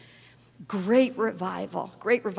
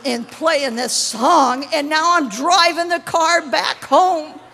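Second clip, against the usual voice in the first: rising louder and higher, slowed; anguished prayer; 6 semitones higher, 7 dB louder, slower than usual. Retold or imitated. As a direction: pour the complaint out to God. Retold crying prayer rising.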